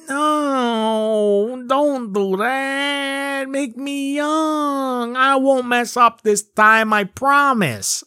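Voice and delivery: high, drawn-out pleading wail; hammed-up slow, high begging voice; Shang Tsung. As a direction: beg for mercy in a whiny put-on accent. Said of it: "Shang Tsung's accent is like that".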